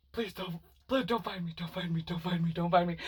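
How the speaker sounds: soft, hushed pleading repeated under the breath; hiding, whispering. Retold or imitated. Hiding in the closet from the couple, hushed pleading. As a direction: beg quietly so no one hears you. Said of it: "I'm, like, really quiet".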